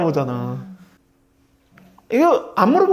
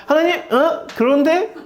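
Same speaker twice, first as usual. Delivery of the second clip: higher-pitched mock challenge to God; mock scolding; 8 semitones higher than usual. Believer telling God off like Peter, mock scolding. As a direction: call God to account, playfully indignant. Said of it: "그러면서 우리가 하나님을 야단친단 말이에요".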